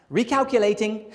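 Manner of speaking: miffed British navigation voice; mad British GPS. Retold or imitated. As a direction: imitate an Englishman annoyed at a wrong turn. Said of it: "he gets mad at you".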